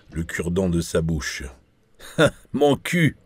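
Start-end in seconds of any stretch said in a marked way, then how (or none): 2.2-3.2 high, loud outburst; belched-out exclamation. A narrator's reading. Bad cop cutting into an interrogation, belched out loudly. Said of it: "a-t-il éructé en anglais".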